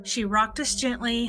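ordinary delivery, nothing marked

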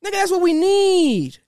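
overexcited raving; hyped-up fan. Real, not acted. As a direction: stretch the words out in high excitement. Fan hyped by imagined battle.

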